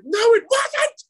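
very high, loud, drawn-out cry; hammed-up sobbing protest; mock hysterical wail. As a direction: shriek it like a tearful tantrum. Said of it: "she was in blistering tears".

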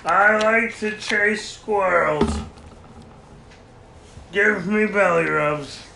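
slow, loud talking-dog voice; Bailey. Acted. Dog taking over the mic, slow put-on voice. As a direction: speak as a dog possessing its owner. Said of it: "She tried to enter your body".

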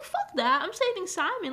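high, loud retold refusal; mock defiant outburst. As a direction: play it as a hammed-up indignant rebel. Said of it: "And I'm like".

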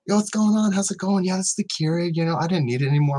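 high, breezy laid-back voice; high relaxed hey-come-in voice; casual friendly greeting. Retold or imitated. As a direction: toss it off high and chill like a friendly stranger. Voices the casual seller couple.

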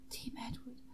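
soft whispered backing line; mock-sultry whisper; whispered echo.

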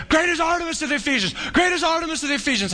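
high, fast mob chant; mock crowd screaming. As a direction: imitate a frenzied crowd without full volume. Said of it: "Can you see 'em screaming?".

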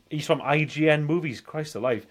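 ordinary talk

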